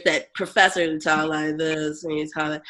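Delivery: low, droning professor voice; monotone professor. Retold or imitated. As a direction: drone it out flat and low. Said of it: "that's how Doctor Teresi talked".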